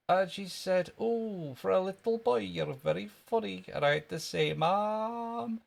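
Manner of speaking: put-on high Scottish little-boy voice; high-pitched comic Scottish boy; Jimmy Krankie. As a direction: tell the tale as a Scottish schoolkid. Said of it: "That was wee Jimmy Cranky".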